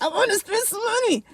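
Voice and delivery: very high, playful squeal; high-pitched mock craving; spending urge. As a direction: voice your inner shopaholic in a high singsong. Voicing her urge to spend.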